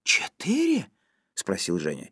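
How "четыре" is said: high-pitched, incredulous echo; astonished question. A narrator's reading. Zhenya hears about the program keys, astonished. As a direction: ask it in amazement, voice leaping up. Zhenya echoes number in surprise.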